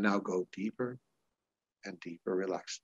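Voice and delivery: hypnotic voice. Shown as a demonstration. Hypnotist deepening a client's trance, low and soft. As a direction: sink the voice down deep. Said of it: "that's the perfect hypnotic voice".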